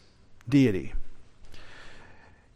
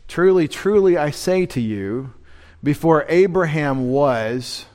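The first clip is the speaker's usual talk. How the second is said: scripture reading. Acted. Reads scripture aloud.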